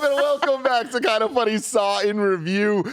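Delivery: high, bright host intro; show intro. Acted.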